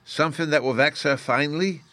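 put-on high, eager childish ask; a boy hoping for mischief; eager question. A narrator's reading. Fairy boy eagerly asking about revenge, high. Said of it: "asked with gleaming eyes".

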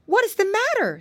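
high alarmed lady voice; a worried lady calling out; pie lady voice. A narrator's reading. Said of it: "she asked".

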